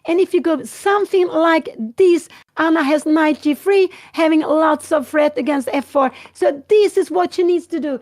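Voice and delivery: loud, urgent live chess commentary; excited commentator leaning into the board; live play-by-play. Acted. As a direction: call every move like it matters.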